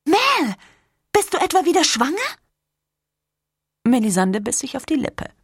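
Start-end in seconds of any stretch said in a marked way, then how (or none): 0.0-2.2 excited shock. Acted. Irma shocked by pregnancy hint.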